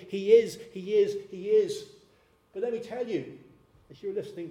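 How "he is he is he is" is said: loud, high, insistent proclamation; retold scream. God's works testifying to the leaders, loud emphatic cry. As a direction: drive each repeat home louder, stretching it out. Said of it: "is screaming out at them".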